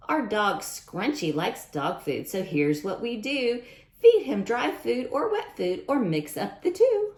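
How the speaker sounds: lively picture-book read-aloud; sing-song storytime rhyme; reading to children. A narrator's reading. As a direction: read the rhymes brightly to a class.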